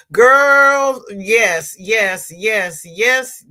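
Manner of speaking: a loud, high burst of agreement; excited exclamation. Real, not acted. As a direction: blurt it out, delighted. Reacting to a comment, exclaiming.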